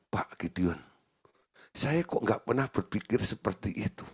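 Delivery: soft, dropped voice of quiet wonder; hushed amazement. Retold or imitated. Director amazed at the pastor's advice, hushed and awed. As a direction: say it in low awe.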